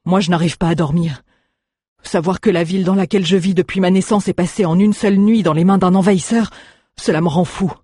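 a hint of an anguished citizen of an occupied town; troubled townsman. Stranger voiced by narrator.

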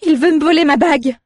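high, loud panicked outburst; blurted exclamation. A narrator's reading. Frightened girl blurting an accusation to the innkeepers. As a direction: blurt it out without thinking. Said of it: "s'exclama Aléa sans réfléchir".

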